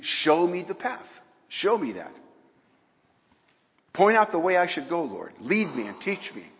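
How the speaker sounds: loud, high pleading prayer; fervent prayer. Acted. Preacher voicing the psalmist's plea to God, loud and pleading. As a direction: cry it up to God.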